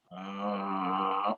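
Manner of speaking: low creaky fry on a held ah; vocal fry. Shown as a demonstration. Voice teacher showing the class vocal fry. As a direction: let the voice crackle at the very bottom. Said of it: "la voz frita es esta".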